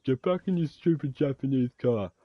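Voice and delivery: high, loud, slow sneering mimicry; mock her like a whiny girl. Girl telling him to get in the car, mimicked high and slow. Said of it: "Chick's like".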